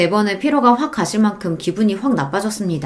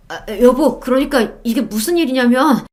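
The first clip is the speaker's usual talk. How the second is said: flustered, higher-pitched husband voice; husband attempting excuses; 5 semitones higher than usual. A narrator's reading. Cheating husband caught by his wife, flustered excuse. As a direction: stammer into a guilty explanation. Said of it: "감히 변명을 시도하는 남편".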